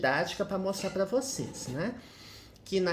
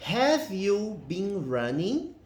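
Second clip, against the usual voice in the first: slow model question; much slower than usual. Shown as a demonstration. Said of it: "aqui temos a pergunta".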